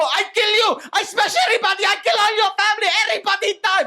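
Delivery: high, loud mock-warrior shouting; hammed-up battle cry. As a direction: roar threats like a cartoon warrior. Comic warrior threat, acted shout.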